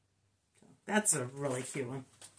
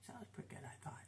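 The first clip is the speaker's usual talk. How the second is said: whispered aside; 18 dB quieter, much faster than usual. Real, not acted. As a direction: say it under your breath.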